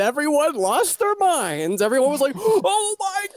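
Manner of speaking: high, hammed-up shocked wailing; mock shock. Friend retelling the community's reaction, hammed-up shocked wail. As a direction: play the crowd losing their minds, half crying.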